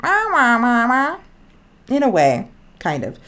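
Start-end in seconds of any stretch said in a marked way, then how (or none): 0.0-1.2 high, loud dolphin mimicry; imitated dolphin talking. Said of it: "it's like".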